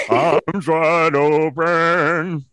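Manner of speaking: slow, loud, loose-jawed rock croon; [name] impression. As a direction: sing it like a post-grunge frontman. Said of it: "impersonating ... Wes Brown doing, uh, a [name]".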